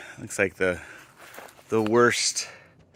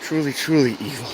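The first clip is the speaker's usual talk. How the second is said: exasperated; 6 semitones higher than usual. Real, not acted. Complaining about the brutal climb.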